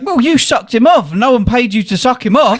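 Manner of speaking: mock incredulous retort; quoting Cole. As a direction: toss it back half-laughing.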